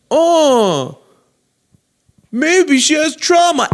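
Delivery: high, slow, whiny mock sympathy; mocking excuse-maker. Mocking people making excuses.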